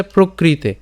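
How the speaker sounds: প্রকৃতে said slowly with clear pra and final e; প্রকৃতে, clearly articulated; careful pronunciation. Shown as a demonstration. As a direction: sound out প্রকৃতে syllable by syllable. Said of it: "উচ্চারণটা কেমন হচ্ছে".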